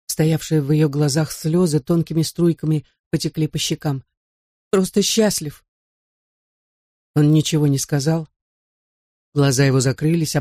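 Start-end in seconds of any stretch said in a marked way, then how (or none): 4.7-5.6 higher, placating afterthought; lightly voiced pleading wife. A narrator's reading. Frightened wife soothes her drunk husband, lightly pleading.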